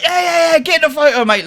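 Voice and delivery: high-pitched boisterous cheer; retold rowdy, high-voiced urging; rowdy excitement. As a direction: imitate hyped-up lads on a night out. Rowdy lads demand a photo.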